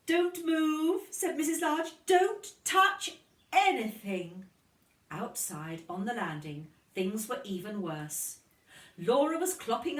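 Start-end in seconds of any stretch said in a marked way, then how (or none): 0.1-4.5 loud, high, slow warning; alarmed mother. Acted. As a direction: freeze the child with alarm. Mother finds baby in lipstick.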